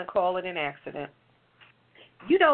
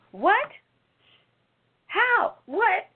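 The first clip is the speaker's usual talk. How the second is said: outraged disbelief; 12 semitones higher, much slower than usual. Real, not acted. Host reacting to the police excuse, stunned and outraged. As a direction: throw out each word in shocked disbelief.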